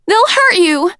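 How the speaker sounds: high, loud panicked cry; panicked shriek. A narrator's reading. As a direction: shriek the warning. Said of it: "Sunny shrieked".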